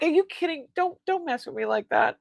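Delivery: mock-scolding disbelief; retold freaking out. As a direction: replay being thrown by good news. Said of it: "I'm, like, freaking out".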